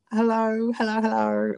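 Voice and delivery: high, mocking British accent for Rue; bad British accent impression. Rue greeting the queens, mocked in a bad British accent. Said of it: "shitty, um, impersonation of the British accent".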